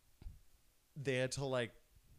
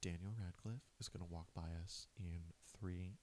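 hushed, low, secretive heads-up; very soft, low confidential voice; retold whisper; 4 semitones lower, 9 dB quieter than usual. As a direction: imitate a hostess leaning in to whisper. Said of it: "whispers both, and she goes".

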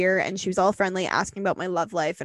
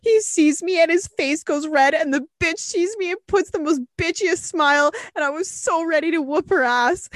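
high, heated gossip delivery; riled-up retelling; 7 semitones higher than usual. Retold or imitated. Host reading the face-off with the other girl, riled up. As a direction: get worked up on the listener's behalf. Host acts out confrontation from story.

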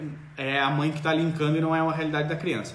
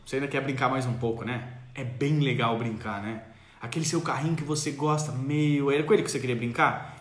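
gentle mother; 5 semitones lower than usual. Retold or imitated. Mother calling child over tenderly to reconnect. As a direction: coax the child kindly. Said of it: "Você vai chamar ele".

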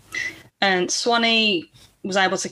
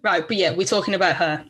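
ordinary talk, nothing marked

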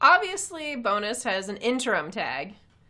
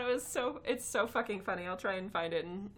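ordinary talk

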